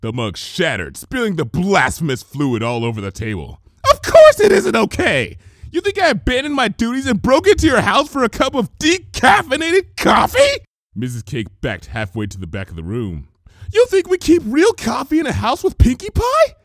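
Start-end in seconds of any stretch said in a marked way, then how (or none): 3.9-10.6 high, loud frantic yelling; high shouting tirade rising on coffee; furious rant. Acted. Twilight yelling at Mrs Cake. 13.7-16.5 high, loud retort; high loud baker rising to Pie; exasperated Mrs Cake. Acted.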